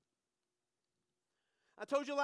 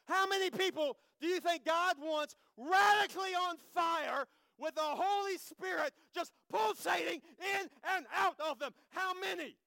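louder and higher, shouting it out; preaching at full force; 5 semitones higher, 4 dB louder than usual. Real, not acted. Preacher shouting a challenge to the congregation. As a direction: fire up the congregation. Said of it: "preacher building to a shout".

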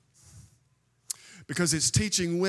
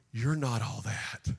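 usual speaking voice

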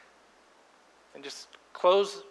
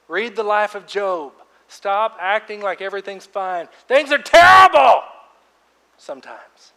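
preaching at full force; 6 semitones higher, 15 dB louder than usual. Real, not acted. Pastor urging congregation to stop pretending, shouting. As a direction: drive it home, voice raised to a shout on terrible.

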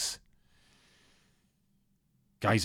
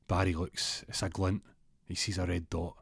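ordinary talk, nothing marked

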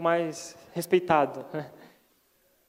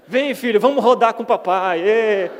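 loud, playful call to a little son; playful dad call; 8 dB louder than usual. Retold or imitated. Dad calling his son to spin around, loud and playful. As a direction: swing the kid round, calling out with fun.

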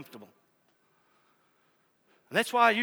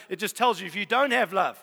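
normal talking voice

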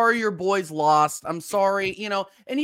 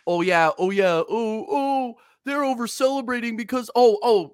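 higher-pitched mock complaint; mocking imitation; 3 semitones higher than usual. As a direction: mimic the critics with put-on indignation. Mimicking critics' complaint about celebrating.

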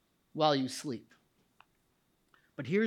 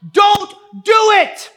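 drawn-out, top-of-the-voice plea; huge, desperate preacher's yell; full shout; 18 dB louder, slower than usual. Acted. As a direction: shout it high and slow to stop him. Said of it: "he shouts".

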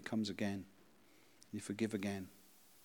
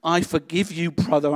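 loud heartfelt cry, pitched higher; cried out; 7 semitones higher, 13 dB louder than usual. Acted. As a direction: cry it out with all your heart. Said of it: "I cried with all my heart".

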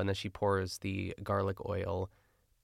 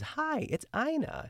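much higher, slow and airy greeting; Ina's high octave; slower than usual. Retold or imitated. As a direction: squeak it out way up high like Ina on the phone. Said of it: "I've never truly heard Ina hit that octave".

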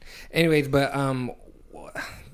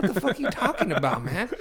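retold indignation; 7 semitones higher than usual. Retelling a confrontation with friend.

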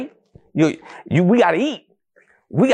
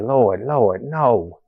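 disdainful; 7 semitones lower, much slower than usual. Retold or imitated. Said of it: "I sat there with so disdain".

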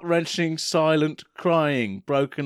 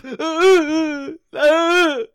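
mock sobbing wail; 12 semitones higher, 5 dB louder than usual. Mocking cry after okay.

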